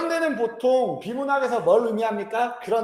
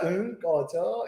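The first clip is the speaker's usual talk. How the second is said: mock dismissive brush-off; 4 semitones lower, 6 dB quieter, much slower than usual. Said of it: "이러잖아".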